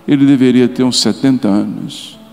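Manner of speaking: ordinary delivery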